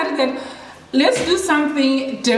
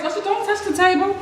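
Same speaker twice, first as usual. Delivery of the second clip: high, firm call to her son; mother calling across the room to Joshua; 8 semitones higher than usual. Real, not acted.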